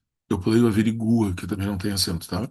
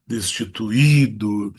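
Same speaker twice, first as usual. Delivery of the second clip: Destituído slow, with a loud long Í; Destituído drawn out, weight on the second-last syllable; stress on last I; 5 dB louder, much slower than usual. Shown as a demonstration. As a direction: push and stretch the Í in "Destituído". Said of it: "agudo no último I".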